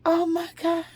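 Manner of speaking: high-pitched breath of wonder; awed amazement. Retold or imitated. Daughter amazed at mother's words, awed exclamation. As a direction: marvel at it, voice lifted high. Retold amazement after gasp.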